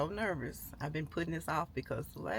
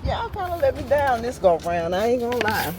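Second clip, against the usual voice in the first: disappointed complaint; 6 semitones higher, 13 dB louder than usual. Real, not acted. Complaining about a dirty car wash.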